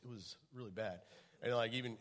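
usual speaking voice

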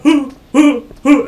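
imitating the killer's laugh; mock villain laugh. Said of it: "any him be like".

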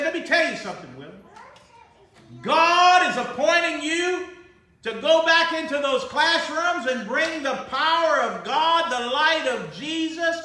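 booming pastor delivery, retold; retold preaching. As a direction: preach it over him like a call from God. Pastor prophesies over the principal.

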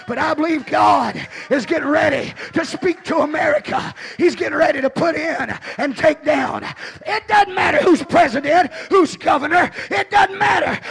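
loud, high, rising sermon shout; full-force preaching. Acted. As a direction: preach it hard to the congregation.

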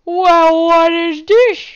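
very loud, high, drawn-out cry; mock outraged yell. Gamer comes upon something in the cave, loud mock yell. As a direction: yell it out, stretching the last words. Sudden loud put-on outburst in game.

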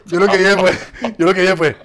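laughing while building up the punchline. Retold or imitated. Telling friends his phone plan, laughing loudly.

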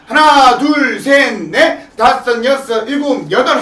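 slow, drawn-out beats; dance count. Shown as a demonstration. Teacher showing the move back left, drawn-out count. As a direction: count out the steps long and clear.

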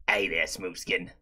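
very low soft rasp; deep ghoul voice. Pretend. Repeats ghoul line lower.

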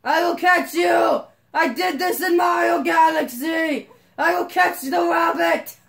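high, loud gleeful shouts; real excitement, shouted; excited shouting. Real, not acted. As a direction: yell with the thrill of the chase.

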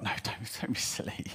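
hushed, dismissive whisper; whispered self-doubt. Acted. Lad's natural self answering the inner voice, whispered doubt. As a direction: talk yourself out of it under your breath.

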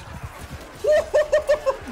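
a long high-pitched laugh; high giggle. Real, not acted. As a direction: cackle in delight. Laughs at horse escape.

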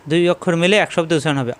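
ordinary talk

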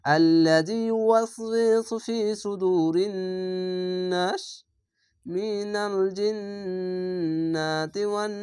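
slow chanted recitation, last word held long; teacher demonstrating tajweed; Quran recitation. Shown as a demonstration. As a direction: chant it and stretch the final নাস. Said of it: "সঙ্গে আমি পড়ছি".

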